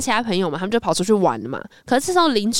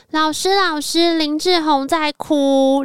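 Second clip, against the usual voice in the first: put-on slow, higher sing-song child tattling; tattling kids; 6 semitones higher, much slower than usual. Children running to the staff room to tattle, sing-song. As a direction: call it out like kids telling on someone. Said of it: "就跑到教室休息室说".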